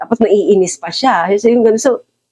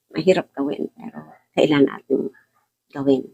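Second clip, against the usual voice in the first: softer, sinking into a sincere hush; softer repeat; 4 semitones lower, 6 dB quieter than usual. Real, not acted. Preacher repeats it softly to the congregation, quiet. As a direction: let it fall quietly as a private truth.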